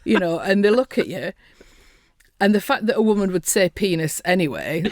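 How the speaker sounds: usual speaking voice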